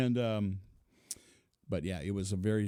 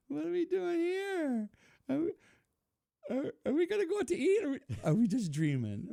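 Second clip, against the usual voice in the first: a high wondering voice, slowed down, laughing through it; imitating fiancee. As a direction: squeak it out like a baffled girlfriend.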